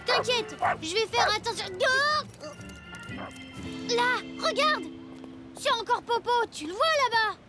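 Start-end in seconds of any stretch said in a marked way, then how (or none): 1.8-7.4 excited. Acted. Sees Popo again.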